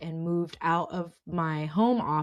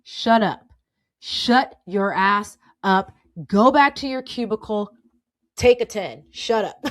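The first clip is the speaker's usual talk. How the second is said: louder, blunt mock scolding; mock angry order; 8 dB louder than usual. Managers ordering V back to her cubicle, mock yelling. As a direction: bark it like a fed-up boss, half joking. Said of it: "but it's just like".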